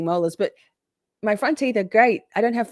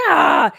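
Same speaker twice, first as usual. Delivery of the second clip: high, loud startled ah; surprised cry; 7 semitones higher, 9 dB louder than usual. Retold or imitated. Chuckling at herself over the comment, a startled ah. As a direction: let out a sudden ah of alarm. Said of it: "I was like".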